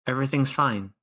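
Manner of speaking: usual speaking voice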